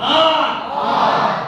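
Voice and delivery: "ஆர்" shouted loud, the second time as a breathy voiceless shout; shouted letter R. Shown as a demonstration. Teacher drills the letters in a vocal warm-up. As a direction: bark out "ஆர்" to open the throat. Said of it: "ஆர் போது அந்த சின்ன ஒரு நாக்கு".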